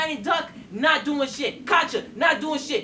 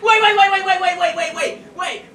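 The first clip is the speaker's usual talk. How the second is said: rapid, high, loud string of waits; frantic waits; 7 semitones higher, 4 dB louder than usual. Real, not acted. About to defend his choice, a frantic high wait. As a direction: scramble to stop everyone, loud and high.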